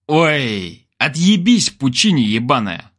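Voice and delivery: irritated brush-off; dismissive. A narrator's reading. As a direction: wave him away with a drawn-out groaning oath. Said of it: "отмахнулся Кир".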